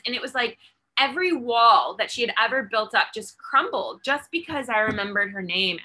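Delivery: normal talking voice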